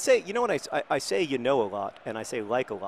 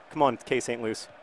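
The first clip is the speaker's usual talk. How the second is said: ordinary talk, nothing marked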